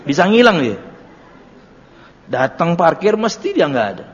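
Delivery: normal talking voice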